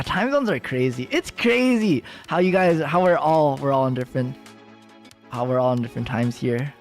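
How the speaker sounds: ordinary talk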